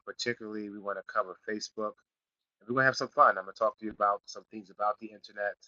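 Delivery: ordinary delivery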